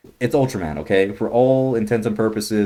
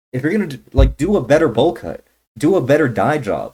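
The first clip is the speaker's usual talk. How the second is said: higher and louder, hammering the point; angry demand; 5 semitones higher, 5 dB louder than usual. Real, not acted. Scolding the film's makers over the haircut, angry demand. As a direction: tell them off. Ranting at the filmmakers.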